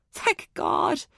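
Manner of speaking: restrained, high-pitched exclamation; mock scream of relief. A narrator's reading. Mother finding her lost daughter, understated scream. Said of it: "Laura screamed".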